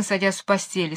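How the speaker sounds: usual speaking voice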